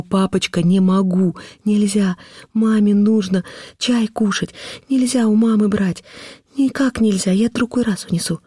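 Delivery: put-on high, drawn-out plea of a little girl; pleading child. A narrator's reading. Girl on her knees begs father, pleading. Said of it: "бросаясь на колени и умоляя его".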